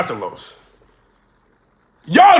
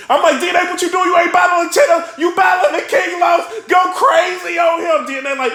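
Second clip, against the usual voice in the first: ordinary talk, nothing marked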